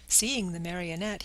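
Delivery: ordinary delivery, nothing marked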